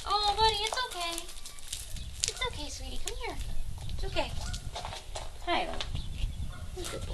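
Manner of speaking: high soft coo to a shy goat. Real, not acted. Zookeeper cooing softly to a shy goat. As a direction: soothe a nervous animal gently.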